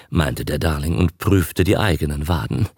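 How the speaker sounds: ordinary delivery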